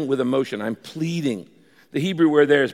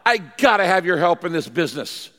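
raised, insistent pleading with God; gut-wrenching prayer; 4 semitones higher than usual. Shown as a demonstration. Praying for help in business and marriage, gut-wrenching plea. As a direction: pour it out emphatically, pressing every phrase. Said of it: "This is gut-wrenching praying".

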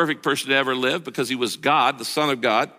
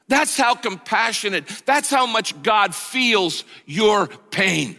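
impassioned preaching; 4 semitones higher, slower than usual. Acted. Preacher climaxing with music.

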